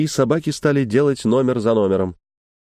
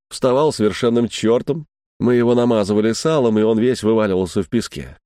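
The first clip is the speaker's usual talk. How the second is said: ordinary delivery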